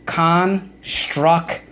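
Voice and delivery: drawn out slowly with a rise at the end; slow, rising teacher model of "Construction"; slow model word. Shown as a demonstration. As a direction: stretch "Construction" out slowly for the class to repeat. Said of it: "Okay, so let's go".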